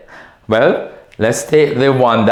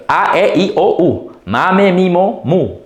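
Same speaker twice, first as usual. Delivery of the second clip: higher, lilting vowel chant; singsong syllable drill; little jingle; 6 semitones higher than usual. Shown as a demonstration. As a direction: recite it like a classroom jingle. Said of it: "That's our famous little jingle".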